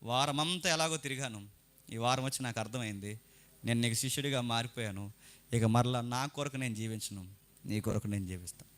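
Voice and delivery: low-pitched imitation of a pledge in prayer; churchgoer's promise. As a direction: mimic a believer's subdued vow to the Lord. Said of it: "వెళ్ళే ముందు ఒక మాట ఇచ్చి వెళ్ళిపోతావు".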